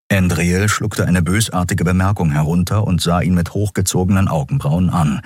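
plain reading voice

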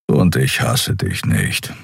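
low and slow, gruff; low slow Kero. A narrator's reading. Kero speaks. (Kero sagt es tief und langsam)

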